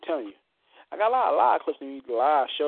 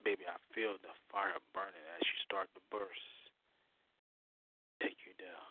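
right in your ear; 6 semitones lower, 7 dB quieter than usual. Acted. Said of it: "as I whisper in your ear".

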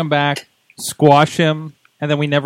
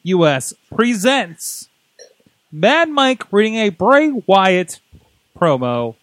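slow, grand announcer intro; mock-formal ring announcer presenting an act; show announcer; 2 semitones higher, slower than usual. Host formally announces the promo stunt.

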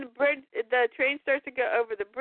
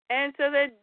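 ordinary delivery

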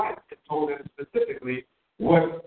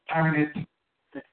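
usual speaking voice

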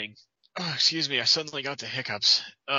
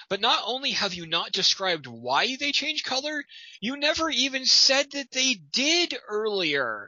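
exasperated rising; 8 semitones higher than usual. Real, not acted. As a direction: voice climbing higher in exasperation. Exasperated critique of the writing.

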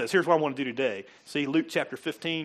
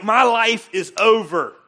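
loud, higher, slowed lament of a hopeless person; despairing voice; 5 semitones higher, 10 dB louder than usual. Retold or imitated. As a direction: voice someone who thinks they've failed. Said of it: "It's like".

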